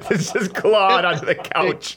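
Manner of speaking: words slowed and broken by laughter; laughing. Real, not acted. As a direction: say it through a real laugh, slowly. Laughing at own joke.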